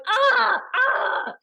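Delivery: big, loud, high wails of pain; hammed-up "aah" of pain; dramatic pain cries. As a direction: overact agony with drawn-out cries. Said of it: "I'm doing this dramatic thing".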